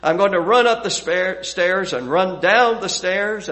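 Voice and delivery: put-on sing-song it like a five-year-old on the couch; little boy's made-up song. Father retelling his son's new song, lightly childlike. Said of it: "The new song went like this".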